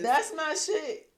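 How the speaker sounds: slow, lazy stoner drawl. Pretend. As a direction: drag it out, dreamy and high. Echoing [name] song line.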